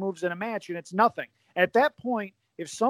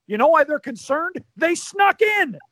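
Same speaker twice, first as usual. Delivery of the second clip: higher, louder, dragged-out sneer; higher, louder mock jab, slowed on the punchline; mock Heenan heckle; 9 semitones higher, 6 dB louder than usual. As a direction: snipe it like a smug heel manager. Heenan heckling Monsoon, quoted.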